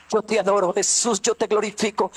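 ordinary talk, nothing marked

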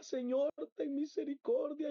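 high-pitched cry for mercy; tearful-edged retold outcry; retold plea. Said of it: "Y yo gritaba".

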